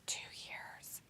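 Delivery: barely audible whispered repeat; hushed whisper. Pretend. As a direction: whisper it under the other person's talk. Teasing repeat under the other's talk.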